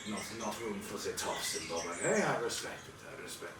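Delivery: lightly voiced fisherman, low-key and approving; old fisherman. Fisherman sizes up a travelled lady, low-key and respectful. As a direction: play a humble, hesitant old local.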